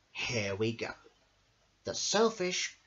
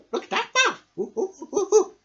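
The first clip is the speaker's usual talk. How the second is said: loud, high monkey hoots; monkey shouting; 11 dB louder than usual. Acted. Monkey shouting about the brave mouse, loud hoots. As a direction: hoot like an excited monkey. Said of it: "shouted a monkey".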